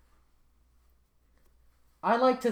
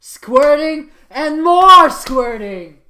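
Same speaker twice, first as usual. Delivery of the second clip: slow loud high shout; hammed-up shout; 8 semitones higher, 14 dB louder, slower than usual. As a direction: stretch and belt it.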